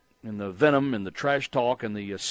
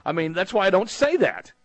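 laughing; 7 semitones higher, 3 dB louder than usual. Real, not acted. Host laughing at own joke.

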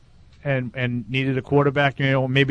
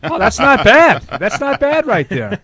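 laughing; 10 semitones higher, 7 dB louder than usual. Real, not acted. Laughing reaction to accent.

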